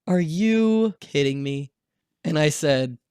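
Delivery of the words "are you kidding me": sigh, then slow, drawn-out disbelief; slow retold dismay after a heavy sigh; disappointed dad. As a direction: imitate an exasperated father. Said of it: "it was so just disappointed".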